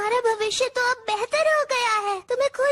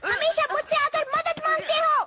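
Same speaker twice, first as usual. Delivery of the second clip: fast, higher-pitched telling-off; angry scolding; 5 semitones higher, faster than usual. Acted. Robot friend scolds the boy for depending on him, angry. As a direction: scold in a quick, raised pitch. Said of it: "इतना गुस्से में क्यों हो".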